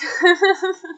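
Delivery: a high, real burst of laughter; amused laugh. Real, not acted. Laughs at sleeping hat.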